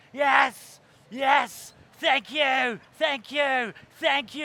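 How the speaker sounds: loud, high, repeated rock-star thanks; hammed-up triumph. CEO takes the stage like a rock star, triumphant. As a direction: bask noisily in a stadium's applause. CEO basks in crowd's applause.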